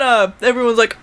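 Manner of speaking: ordinary delivery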